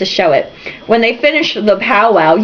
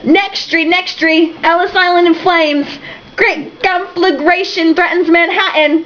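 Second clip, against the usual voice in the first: high newsboy bellow; bellowed headline; 8 semitones higher, slower than usual. Acted. Jack bellowing a fake headline at the crowd, high newsie call.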